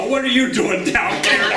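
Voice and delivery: startled call. Retold or imitated. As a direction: play the surprised hunter calling down the slope. Bud startled calling to Jeanette.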